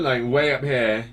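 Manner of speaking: ordinary delivery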